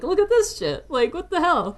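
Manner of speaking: high-pitched mocking banter; joking. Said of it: "kinda, like, joking".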